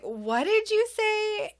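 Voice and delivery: high, drawn-out mock disbelief; shocked question. Parent reacts to apparent swear word.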